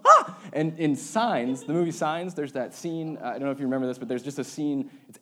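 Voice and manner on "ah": mock jump-scare scream, far higher and louder; sudden scream. Pastor acts out a moviegoer's jump scare with a scream. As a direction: shriek like the monster just jumped out. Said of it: "and then you go".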